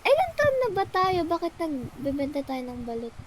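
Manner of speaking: normal talking voice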